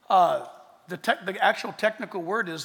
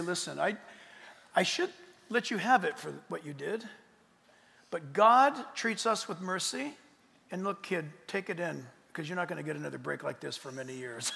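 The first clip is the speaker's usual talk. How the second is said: soft parent; 4 semitones lower, 7 dB quieter than usual. Retold or imitated. Parent quietly granting mercy.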